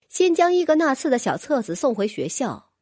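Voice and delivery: plain reading voice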